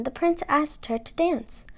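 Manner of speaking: usual speaking voice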